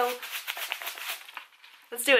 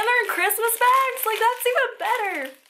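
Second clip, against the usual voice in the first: delighted; 11 semitones higher, 5 dB louder than usual. Real, not acted. Delighted by the Christmas bags.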